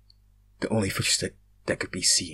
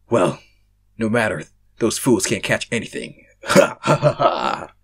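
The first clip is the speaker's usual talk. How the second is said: louder, smug villain ending in a cackle; gloat, then laugh evilly; 6 dB louder than usual. Acted. Monster gloating over the fleeing fools, smug evil laugh.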